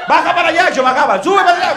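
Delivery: loud, high-pitched comic calling; mock direction-giving. Comedian mimicking confusing Spanish directions, loud and high. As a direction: call it out in mock exasperation.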